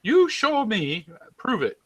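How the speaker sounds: drawn-out stubborn challenge; Mark Taylor catchphrase. Retold or imitated. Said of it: "he has a distinct phrase".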